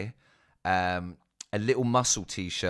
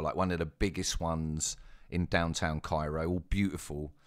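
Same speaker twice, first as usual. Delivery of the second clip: ordinary talk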